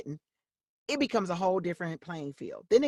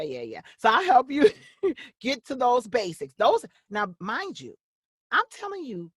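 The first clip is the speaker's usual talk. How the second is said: usual speaking voice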